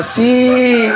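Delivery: high, long drawn-out reply; hammed-up whine; mock sad yes. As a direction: imitate the defeated rival. Imitating sad Camilo.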